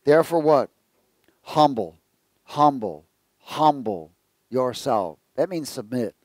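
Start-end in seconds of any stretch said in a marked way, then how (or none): 1.4-5.2 slow, weighty repeated "humble"; emphatic repetition. Real, not acted. Preacher drives home the command "humble yourself", slow and weighty. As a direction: lean on each "humble yourself" slowly.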